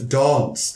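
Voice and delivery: "dance" with a long low ah; "dance" said as dahnce; low vowel. Shown as a demonstration. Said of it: "the low bit on the d".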